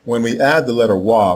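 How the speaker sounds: ordinary talk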